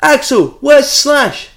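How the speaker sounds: high loud heckle; shouting. Retold or imitated. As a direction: yell it from the crowd. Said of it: "shouted at [name]".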